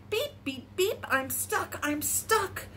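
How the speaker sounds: little truck's mild distress call; crying for help. A narrator's reading. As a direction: plead lightly, high at first. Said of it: "cried the little blue truck".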